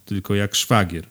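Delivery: usual speaking voice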